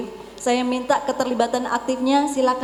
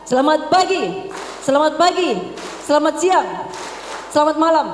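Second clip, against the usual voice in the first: loud higher call; 3 semitones higher than usual. Shown as a demonstration. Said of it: "Are you ready".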